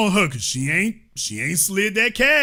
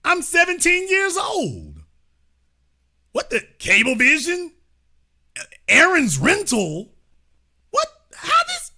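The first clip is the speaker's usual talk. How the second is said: mock teen outrage; 6 semitones higher than usual. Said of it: "when it was time for you".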